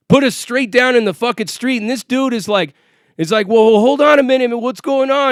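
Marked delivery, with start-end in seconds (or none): 3.5-5.3 higher, louder mock protest; retold arguing. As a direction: imitate a friend objecting to police. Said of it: "he's, like, arguing".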